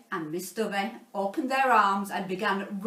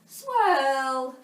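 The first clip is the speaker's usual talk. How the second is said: 1 whooshing wind sound; 4 semitones higher, 5 dB louder than usual. A narrator's reading. Gusts of wind swirled hair.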